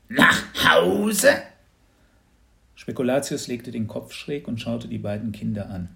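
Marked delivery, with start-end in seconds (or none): 0.1-1.3 high, loud dragon echo of Nach Hause; a hatchling puzzled by the idea of home; rising wondering creature question; surprised baby dragon. Acted.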